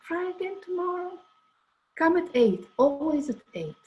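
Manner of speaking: a hint of the master insisting; quoting the teacher. Quoting Sidi's instructions.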